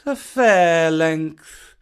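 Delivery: slow, drawn-out proud repeat of "a fair length"; a little pride. Acted. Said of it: "said Kaa with a little pride".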